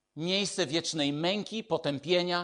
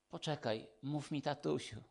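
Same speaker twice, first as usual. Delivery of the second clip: soft, gentle invitation from a loving father; tender fatherly voice; 10 dB quieter than usual. Retold or imitated. God inviting his child to call him daddy, soft and tender. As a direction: say it softly, like a dad calling his child close. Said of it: "Pan Bóg mówi do ciebie".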